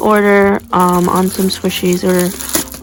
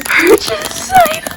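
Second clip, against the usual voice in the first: high delighted squeal, laughing at the end; excited squeal. Real, not acted. Unboxing her purchase for viewers, real excited squeal. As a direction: squeal with joy at the find. Finds the item she bought.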